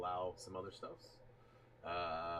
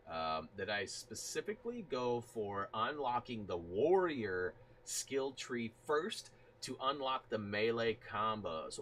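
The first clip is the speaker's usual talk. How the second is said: ordinary talk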